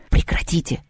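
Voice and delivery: sharp whispered hush; whispering. A narrator's reading. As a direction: hiss it under breath. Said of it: "шёпотом одёрнул".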